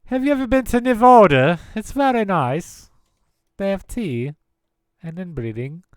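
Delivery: high, posh-mock British, Nevada as /nɪvɔɹdə/; mock British accent. Mocking a British accent to a friend. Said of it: "Like you're British or something".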